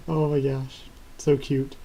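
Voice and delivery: usual speaking voice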